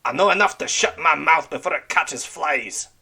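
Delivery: gruff pirate snapping back; snapped. Acted. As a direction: cut him off with a snap. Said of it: "Red Ram snapped".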